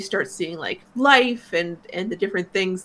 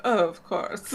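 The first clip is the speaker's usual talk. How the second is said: put-on mild British accent; 6 dB quieter, slower than usual. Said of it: "he said it in that accent".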